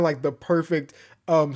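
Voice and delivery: ordinary talk, nothing marked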